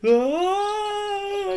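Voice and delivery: high panicked scream; hammed-up panicky scream. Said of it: "He started screaming, like, in a panicky way".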